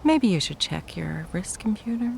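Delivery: normal talking voice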